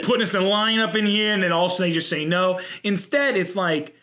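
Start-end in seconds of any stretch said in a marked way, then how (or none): 0.0-2.6 lightly voiced big dude griping; a hint of gym-guy complaining. Imagined muscle man moaning in line, in a big guy voice. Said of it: "big, big guy voice".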